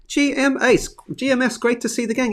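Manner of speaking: bright, lifted greeting to the gang; excited greeting. Real, not acted. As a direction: greet the crew high and happy. Greeting chat crew, +7 st.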